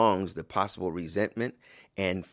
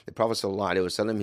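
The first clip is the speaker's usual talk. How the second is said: ordinary talk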